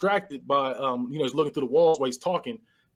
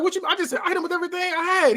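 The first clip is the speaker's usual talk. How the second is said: fast, high-pitched mock alarm; hammed-up rapid defensive squeal; flustered protest; faster than usual. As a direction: blurt it out as a panicked shooter, pitched way up. Retold shooter protesting in disbelief.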